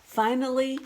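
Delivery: ordinary delivery, nothing marked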